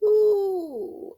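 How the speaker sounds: ordinary talk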